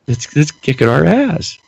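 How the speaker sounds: normal talking voice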